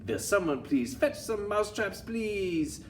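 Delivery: high-pitched mock pleading; hammed-up high plea; demented teacher. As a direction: beg for help like a frazzled teacher. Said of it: "poor demented teachers".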